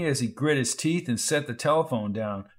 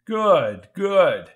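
emphatic relief; 4 semitones higher, 7 dB louder than usual. Acted. Relieved reply.

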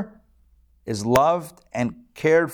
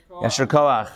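ordinary talk, nothing marked

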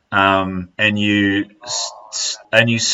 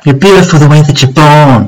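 loud, playful burst of encouragement; loud mock serenade; 2 semitones higher, 9 dB louder than usual. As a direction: belt it out in jest.

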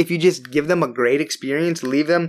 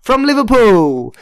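loud, higher, hammed-up Liverpool accent; terrible Liverpool accent; 4 semitones higher, 5 dB louder than usual. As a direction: badly fake a Liverpool accent, playfully. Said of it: "sorry to everyone for my terrible accent".

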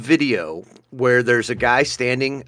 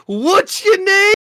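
very high, drawn-out squeal; imitating crying laughter; slower than usual. Man retells video to camera while crying laughing, squealing. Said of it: "he's crying, laughing".

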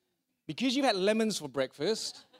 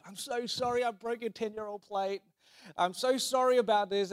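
higher-pitched, gushing apology; profuse apology; 4 semitones higher than usual. Acted. Guilty guest to the friend whose plate broke, profusely apologetic. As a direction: pour out the sorry, pitched up. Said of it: "I apologize profusely".

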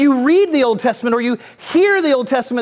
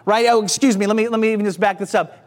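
ordinary talk

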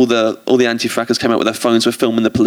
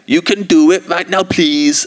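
a hammed-up Jamaican plea, pitched up; dodgy Jamaican accent; 7 semitones higher, slower than usual. [name] urging viewers, in a mock Jamaican accent. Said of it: "putting on a very dodgy accent".

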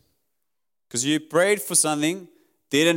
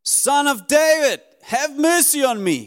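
high, loud cry for mercy; 10 semitones higher, 4 dB louder than usual. Acted. Blind Bartimaeus calls to Jesus for mercy, crying out high and loud. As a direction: call out to someone passing by, pitched well up. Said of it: "he cries out".

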